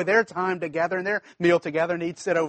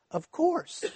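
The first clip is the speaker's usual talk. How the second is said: sudden realization; 9 semitones higher, 6 dB quieter than usual. Retold or imitated. Preacher retells his sudden realization.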